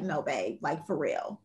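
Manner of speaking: low, quiet, dead serious plea. Retold or imitated. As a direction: drop the voice, no joking now. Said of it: "And I got real serious with him".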